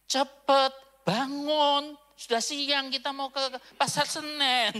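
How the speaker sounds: loud, high wake-up call; waking someone up. Retold or imitated. Brother-in-law shaking the pastor awake, loud and urgent. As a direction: rouse a sleeper urgently. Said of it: "ditepuk bahunya sama iparnya".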